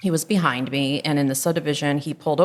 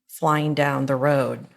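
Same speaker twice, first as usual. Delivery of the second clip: usual speaking voice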